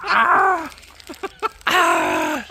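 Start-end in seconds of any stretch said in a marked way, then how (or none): 1.7-2.5 pained yelp. Real, not acted. I just stood on a prick.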